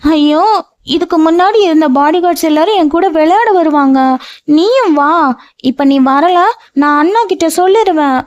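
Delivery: put-on high, pleading little girl voice; a grown woman sulking like a small child, threatening to tell her brother; whiny childlike tantrum; childish whining. A narrator's reading. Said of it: "குழந்தையை போல அடம்பிடிக்க".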